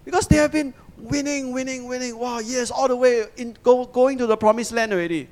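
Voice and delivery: high, eager build-up; excited. Real, not acted. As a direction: cheer on the winning streak. Excited retelling of Israel winning.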